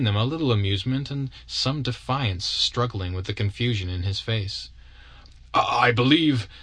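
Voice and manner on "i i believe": halting, tripping over the first word; nervous stammer from a shy suitor. A narrator's reading. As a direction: stumble nervously into it. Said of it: "stammered Brantain".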